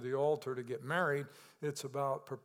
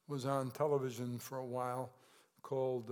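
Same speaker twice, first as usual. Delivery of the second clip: normal talking voice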